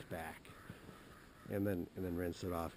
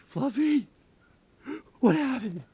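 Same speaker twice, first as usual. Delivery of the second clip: very high, loud wailing cry; high loud mock wail over the dead cat; anguished wail; 15 dB louder, slower than usual. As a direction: shriek the name in grief. Voicing grief-stricken cry.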